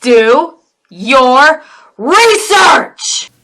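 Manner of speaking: slow, loud drawn-out scold. Acted. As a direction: drag out the reprimand. Scolding the creator.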